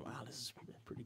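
whisper. Retold or imitated.

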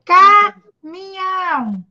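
CAMINHÃO split, a punched "ca", then drawn-out rest; syllable by syllable. Shown as a demonstration. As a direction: slowly break "Caminhão" after the first syllable. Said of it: "eu vou falar nas sílabas". ("Caminhão" bem devagar, separando as sílabas)

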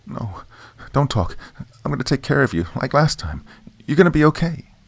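high pleading voice through tears. A narrator's reading. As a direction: beg him to stay, crying. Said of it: "Tony said through the tears".

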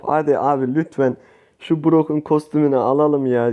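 normal talking voice

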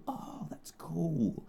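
slow hushed whisper; awed whisper. Real, not acted. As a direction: breathe it out in awe.

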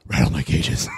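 very low, gruff growl; sexy voice. Pretend. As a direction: rumble it like a smoker. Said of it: "This is my sexy voice".

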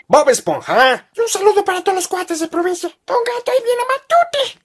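lightly voiced cartoon-announcer tone; TV voices. Kid imitating TV shows, light cartoon voice. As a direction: quote the shows in a TV voice.

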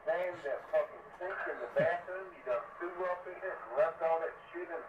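ordinary talk

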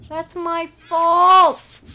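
loud, high drawn-out wail; anguished self-blame. Real, not acted. Player blames self for her death, loud wail. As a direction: wail it, stretching the last word.

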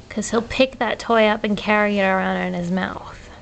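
ordinary talk, nothing marked

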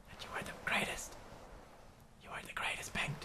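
breathless mock deathbed whisper; hammed-up farewell whisper; dying whisper. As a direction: whisper it like your last breath.

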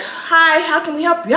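high-pitched, mild customer-service voice; polite office greeter. Retold or imitated. Office staff greeting an angry resident, politely cheerful. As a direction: imitate a polite receptionist.

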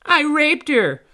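very high, loud fairy scream. A narrator's reading. Tink behind the pirate, high scream. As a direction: scream it back high and hard. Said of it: "and screamed".

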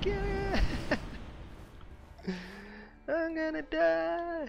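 high, laughing panic; giddy high-pitched yelp; excited. Real, not acted. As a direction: squeal it out mid-laugh.

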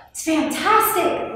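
high, elated shout; shouting back. A narrator's reading. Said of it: "Leo shouted back".

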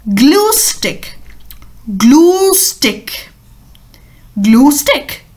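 glue stick said clearly three times; model pronunciation. Shown as a demonstration. Teacher drills glue stick for children. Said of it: "so it's said".

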